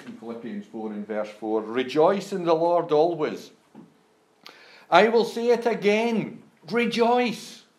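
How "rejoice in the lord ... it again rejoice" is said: loud, much higher call to rejoice; emphatic proclamation. Shown as a demonstration. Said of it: "Now that's quite emphatic".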